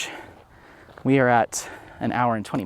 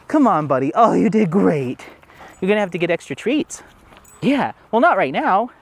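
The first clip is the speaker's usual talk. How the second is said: high, louder sing-song praise; 6 semitones higher, 4 dB louder than usual. Real, not acted. Owner gushing at her dog after he came back, high and loud.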